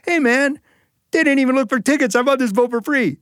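high, fast, pleased with himself; cocky brag. Retold or imitated. Young stowaway brags to the French guy, high and fast. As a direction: crow about the free ride.

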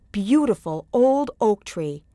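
usual speaking voice

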